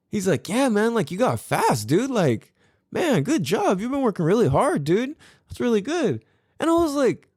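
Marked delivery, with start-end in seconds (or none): 0.5-6.2 higher, lightly voiced friendly teen; hint of a cheerful son complimenting dad; son's praise. Voicing son's kind praise.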